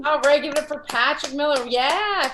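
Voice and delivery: high, loud whoop into an applause call; rally the room to applaud; real cheering host. Real, not acted. Host bringing applause for a comedian after his set, cheering.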